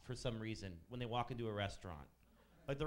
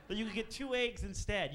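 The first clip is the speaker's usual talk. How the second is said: higher, mocking incredulity; incredulous; 7 semitones higher than usual. Waiter scornfully echoing the egg request, higher. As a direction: repeat her words high and scornful.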